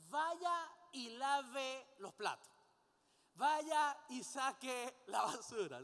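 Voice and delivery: loud, slow, drawn-out command; acted order. Preacher showing someone ordering household chores, acted order. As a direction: give orders one stretched word at a time. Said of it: "¿Y qué es la orden?".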